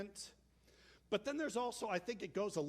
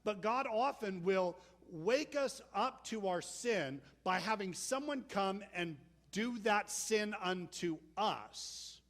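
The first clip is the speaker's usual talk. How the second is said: ordinary talk, nothing marked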